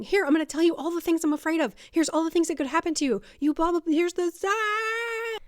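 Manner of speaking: fast, loud, high panicky rush; frantic outpouring. Acted. Madwoman finally listened to, pouring out fears, frantic. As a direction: race through the fears, climbing to a cry.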